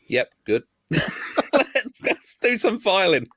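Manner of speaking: overly cheerful guy, high and slow; cheery fake smile voice. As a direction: beam through it like a too-happy new boss.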